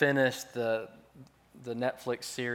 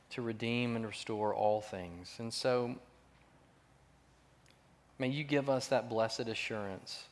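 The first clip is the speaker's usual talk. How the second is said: prayer. Acted. Closing prayer.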